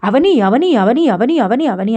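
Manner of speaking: loud, high shouted calls that fade a little; frantic shouting of Avani; shouting her name. Retold or imitated. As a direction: yell for her in shock. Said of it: "గట్టి గట్టిగా అరుస్తాడు".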